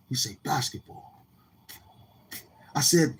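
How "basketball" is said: mumbled answer; muttering it back shyly; quiet mumble. Retold or imitated. He answers with one word.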